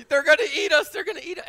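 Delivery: put-on high, frightened kids' wail; panicked kids. Pastor imitating crying kids in the basement. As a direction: cry it out like terrified children. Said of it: "with kids crying".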